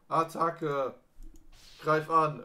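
loud, high attack call; battle cry. Acted. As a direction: shout the attack order. Calling out attack.

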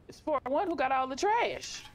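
high-pitched indignant exclamation; indignant outburst. Real, not acted. Player finding trash at the door, high indignant outburst. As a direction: exclaim it high, dragging out the complaint.

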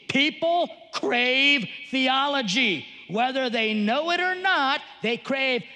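slow, emphatic sermon voice; building preaching. Acted. Preacher driving his point home to parents. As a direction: preach it out, word by word.

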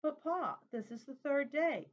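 put-on high, eager little girl's plea; pleading child voice. A narrator's reading. Little girl pleading with her father on the road, high and insistent. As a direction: press Pa like a tired small child.

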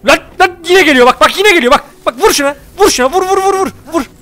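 high, urgent calls to hit; panicked gamer urging attack; excited yelling. Real, not acted. Gamer panicking at an enemy, high excited yells.

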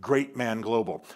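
slow, with "Great Man" blended into one word; run together. Shown as a demonstration. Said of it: "You run the great and the man together".

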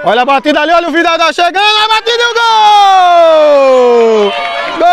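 building to a high long goal shout; explosive goal commentary. Real, not acted. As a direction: rise fast then hold the goal high.

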